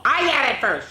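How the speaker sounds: put-on high, loud kid claim; childish shout. Kid arguing at recess, high loud claim. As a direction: squabble over the ball in a high yell. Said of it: "shouted one".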